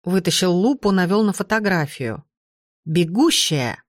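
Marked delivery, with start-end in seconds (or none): 2.8-3.8 a touch of triumph on one word; triumphant exclamation. A narrator's reading. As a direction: announce the find with restrained triumph. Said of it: "торжествующе воскликнул он".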